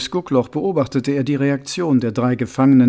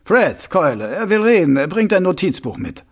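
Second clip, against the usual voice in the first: calling out; 6 semitones higher, 4 dB louder than usual. A narrator's reading. Mumm calls out to guards.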